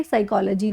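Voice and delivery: soft, low "साइकोलॉजी" as sai-KOL-uh-jee; silent P. Shown as a demonstration. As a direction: start "साइकोलॉजी" on S, reduced ending. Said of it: "इसको pronounce क्या करोगे?".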